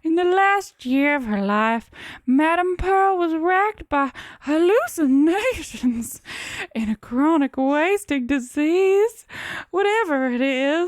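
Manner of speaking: high, slow Southern drawl; comic Southern accent. Acted. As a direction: stretch every phrase like a Southern gentleman. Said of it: "You're definitely the South".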